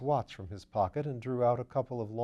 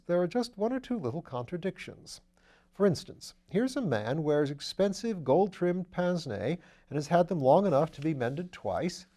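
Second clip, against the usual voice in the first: lightly voiced upper-class gent; airy, amused aristocrat; breezy gentleman voice; 6 semitones higher, 5 dB louder than usual. A narrator's reading. Wimsey's voice in the reading.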